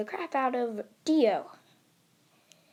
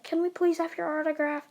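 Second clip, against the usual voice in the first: higher, quick fan voice; eager fangirl; 6 semitones higher, faster than usual. Acted. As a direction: ask fast in a raised, girlish pitch. Swarm of girls asking hero.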